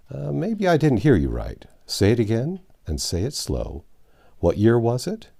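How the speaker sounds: ordinary delivery, nothing marked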